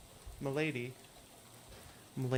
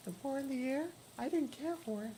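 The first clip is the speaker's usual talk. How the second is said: put-on much higher, thin ghostly girl voice; hitchhiker Lydia; 11 semitones higher than usual. Hitchhiker asking the player for a ride, high ghostly voice. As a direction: play the strange girl in the rain begging a lift.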